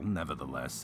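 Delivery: plain reading voice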